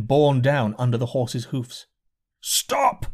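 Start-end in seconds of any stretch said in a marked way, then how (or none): 2.4-3.1 mild narrated scream. A narrator's reading. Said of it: "screamed my brother".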